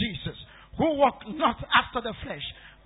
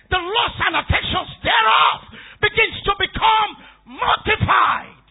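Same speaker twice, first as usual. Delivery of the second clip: preaching at full force; 8 semitones higher, 11 dB louder than usual. Acted. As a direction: thunder it from the pulpit, stretching the words. Preacher climaxes on diminishing self.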